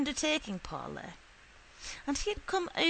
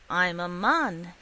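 sullen dignity; 3 semitones lower, 6 dB louder, slower than usual. Acted. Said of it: "with a sullen dignity".

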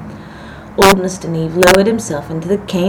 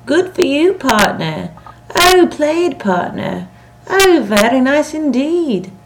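high, ringing tennis-court cheers; 7 semitones higher, 4 dB louder, slower than usual. A narrator's reading. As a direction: call out across the court to your partner. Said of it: "hear Marian's voice ring out".

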